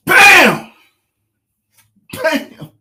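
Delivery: very loud, high shout; excited bam. Real, not acted. Angler shouting as a fish strikes. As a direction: yell it as the fish slams.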